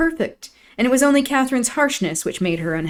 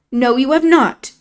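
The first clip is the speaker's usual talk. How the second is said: raised, louder, defiant; defiant denial; 6 semitones higher, 6 dB louder than usual. A narrator's reading. Isabella defying Catherine over Heathcliff, defiant. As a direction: insist hotly. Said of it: "said the infatuated girl".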